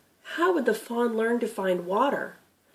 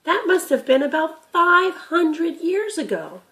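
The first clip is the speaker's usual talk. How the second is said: put-on lightly raised, curious boy; a young grandson asking, a bit higher; boy Tim's voice; 8 semitones higher, 5 dB louder than usual. Grandson asking Gramp about the deer, curious boy voice.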